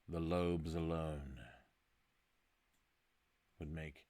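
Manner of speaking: soft, slow mentalist delivery; hushed mystic invitation. Acted. Host daring listeners to enter his mind, hushed mystic. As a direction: murmur it slowly and portentously.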